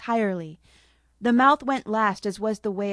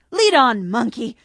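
louder, grand commanding order; grinning command; 3 semitones higher, 7 dB louder than usual. Acted. Ruby commands the monkey to lead, grinning and grand. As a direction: order the monkey ahead with a grin. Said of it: "she commanded, grinning".

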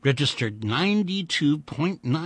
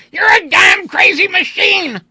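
high, very loud screaming rage; furious shout; 14 dB louder than usual. A narrator's reading. Vandeleur screaming at his android, furious shout. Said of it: "Vandeleur shouted".

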